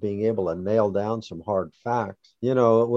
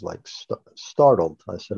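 usual speaking voice

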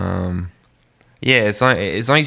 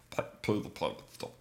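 trying a voice; 4 semitones lower, 15 dB quieter than usual. Acted. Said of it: "I gotta get a voice for him".

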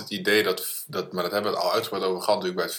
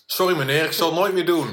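higher-pitched, louder pretend child apologising; mock meek apology; 8 semitones higher, 4 dB louder than usual. Co-host guessing the cyclist kids' reply, mock apologetic. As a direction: play a contrite kid saying sorry.

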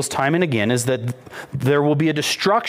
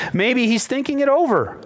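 mocking; 3 semitones higher than usual. Elijah taunts Baal's prophets about their absent god, mockingly. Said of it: "Mockingly, right?".

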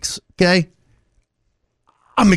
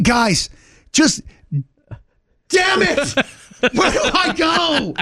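exasperated; 7 semitones higher, slower than usual. Retold or imitated. Exasperated at costumed guides.

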